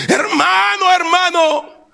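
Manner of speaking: high-pitched cry, re-enacted; acted frantic calling; desperate shouting. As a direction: cry out to a dying brother, voice way up. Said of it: "yo seguía gritando con, con más fuerza".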